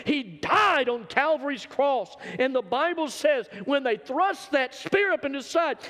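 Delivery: rising pitch and volume into a shouted climax; preaching at full force. Acted. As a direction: build the sermon up to a roar.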